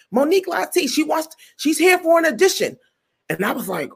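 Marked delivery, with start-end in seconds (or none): 0.1-2.8 high-pitched introduction to the room; announcing a newcomer. Retold or imitated. Man introducing a comedian to the producer, announcing. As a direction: present someone importantly, acted out.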